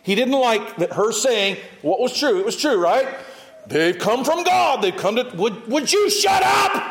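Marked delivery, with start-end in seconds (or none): 3.7-6.8 exasperated shout. Retold or imitated. Paul fed up with the shouting girl, building to an angry yell. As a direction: lose patience and yell at her.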